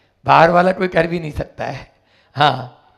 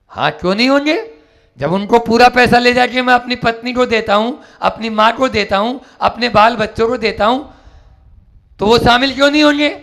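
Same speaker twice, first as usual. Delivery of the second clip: fast, higher, heated; defiant retort; 5 semitones higher, faster than usual. Acted. Bandit insisting his family shares his sins, fast and heated. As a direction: argue back quickly and hotly.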